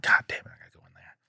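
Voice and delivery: quick whispered grumble; rushed, reluctant whisper; exasperated whisper. Retold or imitated. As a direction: whisper it to yourself, fed up. Exasperated thought to himself.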